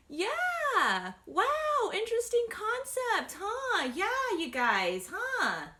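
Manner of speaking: high loud sarcastic jab; mock amazement, dripping sarcasm. Real, not acted. Sarcastic jab after rant.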